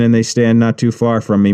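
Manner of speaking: usual speaking voice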